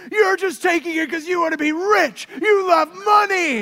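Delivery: high, loud mock child shout; imitating angry daughter. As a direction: shout like an upset little girl. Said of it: "one time she said".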